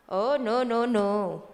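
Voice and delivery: normal talking voice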